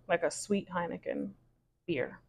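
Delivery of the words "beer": ordinary delivery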